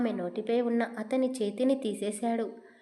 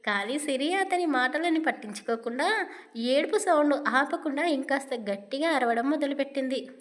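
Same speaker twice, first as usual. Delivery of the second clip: ordinary delivery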